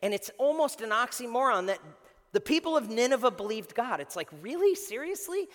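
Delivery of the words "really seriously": rising, high-pitched disbelief; incredulous. Acted. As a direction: ask it like you can't believe your ears. Reacting to murderers believing God.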